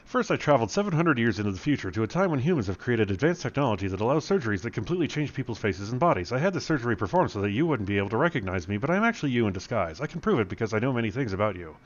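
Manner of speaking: fast, rushed explanation; rattling off the story. Acted. Paul rushing his lie to Bruce, fast. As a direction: race through the excuse.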